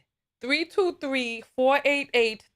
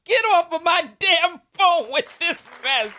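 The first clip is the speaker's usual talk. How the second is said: higher and louder, play-scolding; mock exasperation; 6 semitones higher, 4 dB louder than usual. Host mock-scolding the caller to get off the phone, exasperated. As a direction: shoo the caller off, half-joking.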